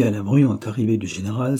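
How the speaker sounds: ordinary delivery, nothing marked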